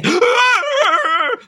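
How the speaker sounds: high shouted mock gasp; hammed-up gasp of shock. Mocking suspect's fake breakdown.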